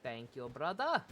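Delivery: put-on slow Jamaican accent, drawn-out brother; playful Jamaican accent, brother stretched; mock Jamaican accent. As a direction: imitate the island character thanking the shopkeeper. Echoing the game character's accent.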